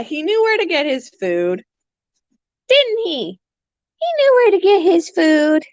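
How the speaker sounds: high sing-song pet voice; squeaky pet chatter. Real, not acted. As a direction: coo at the parrot. Talking to bird.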